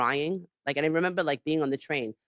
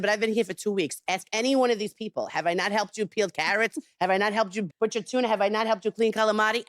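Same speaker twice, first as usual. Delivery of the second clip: retold protest, raised pitch; insistent self-defence; 7 semitones higher than usual. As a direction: argue your case point by point, as retold.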